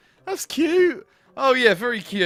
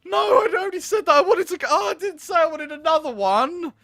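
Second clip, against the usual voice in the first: high, loud and outraged; exasperated protest; 10 semitones higher, 5 dB louder than usual. Real, not acted. Player protesting the date choice, high and shouting. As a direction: yell at the game in disbelief.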